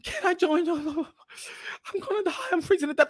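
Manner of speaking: high, soft, feeble plea; imitating a weak, freezing woman; pleading woman. Freezing woman asking to join him, high feeble pleading.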